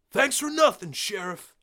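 high, loud parting shot; bitter shout. Acted. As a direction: hurl it over his shoulder. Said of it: "he shouted".

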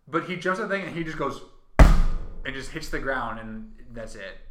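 ordinary delivery

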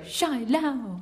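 mocking Mandarin accent. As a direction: tease a colleague by parroting the name. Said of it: "we always go".

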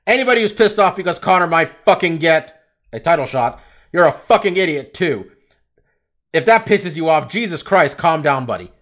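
ordinary talk

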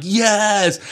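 loud, high-pitched mock frustration; exasperated. Teased brother answers his sisters, exasperated. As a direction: blurt it out in hammed-up exasperation. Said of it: "It's so frustrating".

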